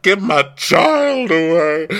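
loud, high, drawn-out yell; hammed-up parental outrage; mock screaming. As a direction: imitate a frantic mother. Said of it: "keeps screaming".

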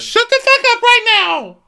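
very loud, piercing mock squeal; Brandy impression. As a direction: shriek it at the top of your range. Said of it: "Brandy squeals. That's how she talks".

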